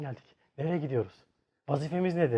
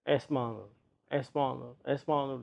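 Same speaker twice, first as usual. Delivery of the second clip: soft, lower murmuring of a name; delirious murmur; 4 semitones lower, 11 dB quieter than usual. Retold or imitated. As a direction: mumble it half-conscious. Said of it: "Kızın ismini sayıklıyor". (yarı baygın, alçak sesle sayıklama)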